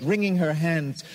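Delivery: ordinary delivery, nothing marked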